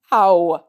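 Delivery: a loud pained yelp; sharp yelp. A narrator's reading. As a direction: yelp at the sudden slap. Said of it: "he yelps".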